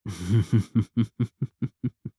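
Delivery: mocking laugh at the heroes; villain laugh. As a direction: laugh at their pathetic offer. Villain mocking the group's offer.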